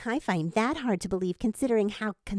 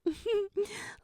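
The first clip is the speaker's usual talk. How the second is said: soft high laugh of relief; relieved laugh; 8 semitones higher, 5 dB quieter than usual. A narrator's reading. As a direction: laugh out the fear. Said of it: "The mother laughs with relief".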